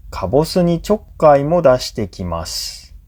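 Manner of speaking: ordinary talk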